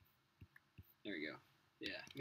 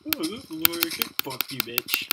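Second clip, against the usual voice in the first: loud, higher put-on voice; mock shout; 5 semitones higher, 11 dB louder than usual. Naming his in-game cake, a loud mock yell. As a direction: yell it like a cartoon brat.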